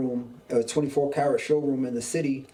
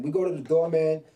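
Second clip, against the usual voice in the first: usual speaking voice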